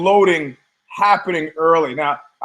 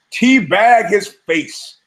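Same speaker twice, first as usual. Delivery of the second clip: "Teabag his face" drawn out slowly, louder; slow emphatic quote; 3 semitones higher, 4 dB louder, much slower than usual. Retold or imitated. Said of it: "you could say".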